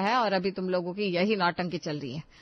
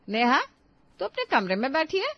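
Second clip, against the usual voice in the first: calling out; 7 semitones higher than usual. Acted. Calling out to Neha.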